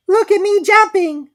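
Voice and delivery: put-on very high, loud squeaky baby voice; squeak. A narrator's reading. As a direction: squeal it like an excited little joey. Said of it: "squeaked Roo".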